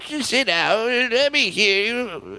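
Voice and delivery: high trembling grandpa voice; trembling old man voice. Acted. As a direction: quaver like an old grandpa telling a story. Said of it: "your old man voice".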